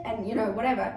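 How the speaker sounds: ordinary talk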